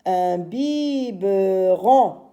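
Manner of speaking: "Un biberon" very slow, syllable by syllable; syllabes détachées. Shown as a demonstration. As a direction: draw out each syllable of "biberon". Teacher reads the rebuilt word. (« biberon » lu lentement, syllabe par syllabe)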